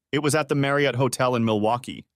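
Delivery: ordinary delivery, nothing marked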